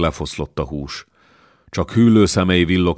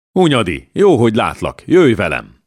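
hearty loud hail, pitched up; calling out; 6 semitones higher, 6 dB louder than usual. Acted. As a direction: hail him loudly and order him along. Old lord hails Hunyadi on meeting. (hangosan odakiáltva)

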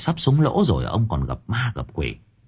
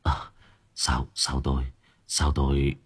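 faint, low, much softer voice; weak; 8 semitones lower, 8 dB quieter than usual. A narrator's reading. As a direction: murmur it feebly, as if just coming round. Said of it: "Ông hai yếu ớt". (giọng yếu ớt, thều thào)

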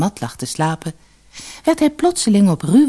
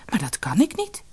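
baffled protest, pitched up; astonished; 6 semitones higher than usual. A narrator's reading. Tao tells Lotusbloem he can't build it, astonished. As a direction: object in higher-pitched bewilderment. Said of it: "Tao keek verbaasd".

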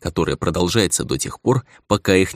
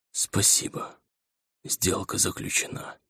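put-on softer, slow, faint old woman whisper; weak whisper; 10 dB quieter, slower than usual. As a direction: whisper it, drained of strength. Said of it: "шепнула старуха ослабевшим голосом". (слабый шёпот старухи)

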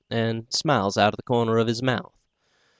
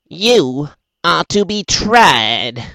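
higher, louder, slow sing-song; shrill sing-song; 6 semitones higher, 7 dB louder, much slower than usual. Acted. The little man charging the doctor, shrill sing-song. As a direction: sing it out to cut the nerves. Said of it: "shrill sing-song".

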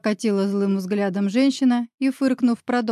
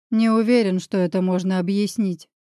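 usual speaking voice

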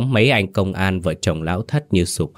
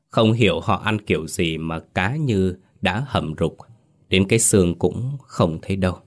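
plain reading voice

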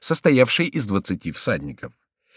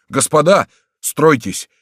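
loud, raised order; shouted command; 6 semitones higher, 6 dB louder, slower than usual. A narrator's reading. Guard captain to his militia, shouting orders. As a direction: order the men into line. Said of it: "закричал начальник караула".

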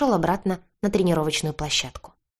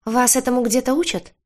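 mild shout, pitched high; raised-voice indignant question; called out; 7 semitones higher than usual. A narrator's reading. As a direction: throw the question after someone leaving. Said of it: "крикнул Рыжий мне в спину".

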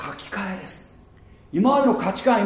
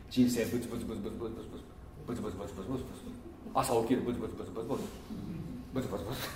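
fast, soft mumbled muttering under the breath; imitating grumbling; 7 semitones lower, 15 dB quieter, much faster than usual. Preacher mimics a grumbling person, mumbling fast and soft. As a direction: mutter on and on like a chronic grumbler, much quieter. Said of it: "ブツブツ言うな".

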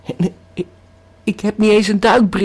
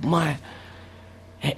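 ordinary delivery

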